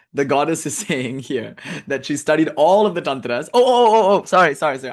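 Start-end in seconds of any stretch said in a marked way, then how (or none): 3.5-4.9 a real burst of excited remembering, raised and louder; oh oh oh of sudden recall; sudden realization. Real, not acted. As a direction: catch yourself as the thought comes back. Suddenly remembers lost thread.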